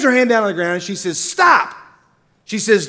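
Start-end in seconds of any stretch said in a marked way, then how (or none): 1.2-1.7 high barked order; mock-commanding stop; sharp stop. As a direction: halt them with one word. Lady slams hand, commands stop.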